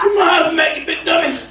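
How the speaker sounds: put-on high, shouted old-man yell; Sanford voice. Comic retrying the Sanford bit, high shout. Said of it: "It worked last week".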